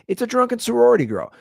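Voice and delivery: high, loud, exaggerated "drunken sorority girl"; mock exasperated dad. Dad mocking his toddler's mood swings, high and loud. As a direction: throw the line up high and loud like a punchline. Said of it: "I was like".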